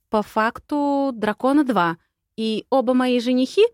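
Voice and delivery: put-on a girl who can't believe her ears; stunned disbelief. Heroine learning both dragons are her grooms, stunned and high. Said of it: "прифигела я".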